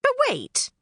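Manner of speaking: dawning pixie surprise. Acted. Knotgrass realizing the rain stopped, high surprised. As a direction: stop short in surprise.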